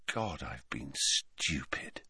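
slow, hushed near-whisper; low whispered self-reproach; quiet. A narrator's reading. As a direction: breathe it slowly, as dawning realisation. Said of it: "he said quietly".

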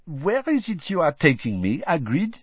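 put-on high, playful French-accented voice; playful French lady. A narrator's reading. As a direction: tease him lightly, pitched well up. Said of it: "she said playfully".

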